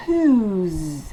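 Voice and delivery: "whose" ending in a buzzing z; final z sound. Shown as a demonstration. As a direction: end "whose" on a clear z. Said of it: "Comme un Z à la fin". ("whose" avec un z final)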